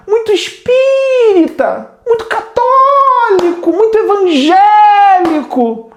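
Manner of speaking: very high, slow, wailing piety; hammed-up churchy singsong; mock religious voice. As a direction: parody the devout with long, soaring stressed vowels. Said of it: "Quem gosta de falar desse jeito são religiosos".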